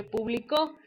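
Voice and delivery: publicó with the stress on the final CÓ; stress on last syllable. Shown as a demonstration. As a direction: punch the end of publicó: pu-bli-CÓ. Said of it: "No se escucha igual". (publicó con acento en la última sílaba, CÓ)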